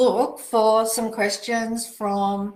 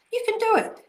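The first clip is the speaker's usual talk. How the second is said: excited cheer; 14 semitones higher, faster than usual. Real, not acted. Host cheering on a viewer who wants clear skin, excited and much higher. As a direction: pitch leaps much higher, a bright rallying call.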